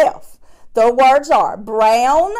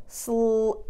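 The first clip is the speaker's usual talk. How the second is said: softer slope; 9 dB quieter than usual. Shown as a demonstration. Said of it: "listen for the end".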